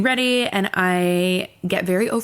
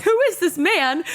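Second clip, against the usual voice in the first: squeaky, much higher alarmed question; shocked disbelief; 12 semitones higher than usual. Retold or imitated. Telling roommate about the man in her doorway, high stunned question. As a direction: pitch it way up like you can't believe it.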